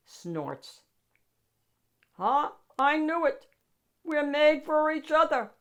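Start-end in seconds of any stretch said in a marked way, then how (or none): 2.2-5.5 higher, louder fond monster growl. A narrator's reading. Gabe tells the child they belong together, growling fondly. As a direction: growl it with affection. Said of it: "he growled".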